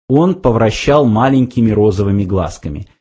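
ordinary delivery, nothing marked

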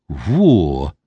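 surprised low grunt; amazed exclamation. A narrator's reading. The lout gasps at the feathered elder, amazed. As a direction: blurt out astonishment. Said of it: "изумился детина".

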